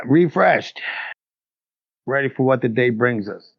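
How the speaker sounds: ordinary delivery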